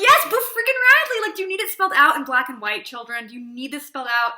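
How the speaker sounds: put-on high, loud exasperated yell at the kids; exasperated outburst. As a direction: blow up at clueless students, mock fed up. Exasperated at students' wrong answer.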